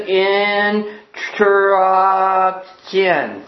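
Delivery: "Instruction" dragged out extremely slowly in halting pieces; pupil sounding out. Acted. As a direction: struggle through "Instruction" sound by sound. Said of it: "And so Johnny says".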